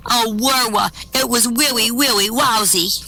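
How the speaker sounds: high voice, "willy" for really, R as W; comic speech impediment. Acted. Kim to her roommate, "wowsy" for lousy, R and L as W.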